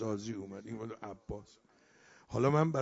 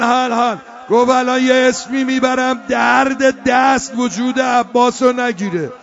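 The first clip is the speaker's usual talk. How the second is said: loud, high-pitched lament; shouted, rhythmic exhortation to grieve; impassioned mourning preaching; 8 semitones higher, 15 dB louder than usual. Acted. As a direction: preach at full force, almost chanting. Mourning preacher at full force.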